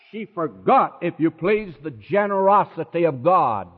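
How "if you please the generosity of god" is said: ordinary talk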